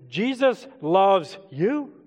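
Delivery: "Jesus loves you" slowly, rising on "you" as a question. Shown as a demonstration. Preacher varying the message for church, "Jesus loves you" as a slow rising question. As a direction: draw out "Jesus loves you", lifting "you" at the end. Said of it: "Do you see how we take".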